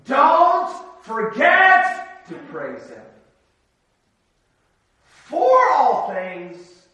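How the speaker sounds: booming slow pulpit appeal; emphatic exhortation. Real, not acted. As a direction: drive the point home to the congregation. Sermon climax, key exhortation.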